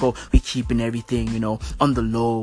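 low, hushed mock secret-agent voice; secretive CIA mock. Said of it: "they wanna be all C I A".

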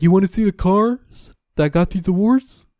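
hammed-up host teasing the big reveal; mock game-show host. Mocking the host of the commercial, showman voice. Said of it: "And then he's like".

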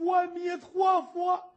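loud, high, drawn-out cry of relief; a scholar's slow shouted thank-heavens; relieved shout. Acted. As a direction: let the prayer burst out in relief. Scholar relieved the maid spoke.

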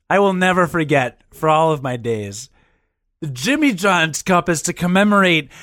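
normal talking voice

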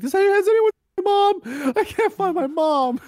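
put-on high, loud little-kid wail for mom; lost child voice. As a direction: play a lost kid calling for his mom. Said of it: "go into public stores and be like".

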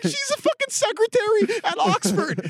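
very high mocking falsetto, slow; mock British cop. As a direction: squeak it out like a snooty cop. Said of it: "it'll be like".

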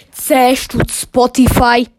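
very loud, slow prize announcement; loud Swiss German hype; hyped shout. Real, not acted. Hyping their prize in Swiss German.

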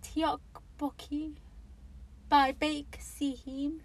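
[name] sounded out very slowly; carefully attempting [name] by [name]; hesitant foreign title. Real, not acted. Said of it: "I'm gonna butcher this".

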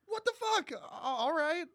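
high-pitched retold disbelief; stunned reaction. Host recalls reacting to the abrupt opening death, baffled. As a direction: squeak out the bafflement, then falter.

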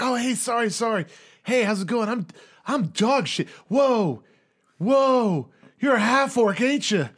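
high, overexcited underdog, apologetic then awed; eager pledge voice. Acted. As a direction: babble hello, then marvel loudly. Plays Dog Shit meeting half orc.

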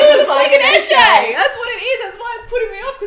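squealing high, loud, through laughter; can barely get it out for laughing. Real, not acted. Still laughing at the joke.

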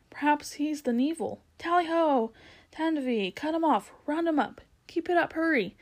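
high, urgent hunting calls; excited shouting. A narrator's reading. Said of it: "they shouted".

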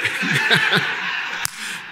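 breathy chuckle; quiet amused laugh; soft laugh. Real, not acted. Laugh after story.

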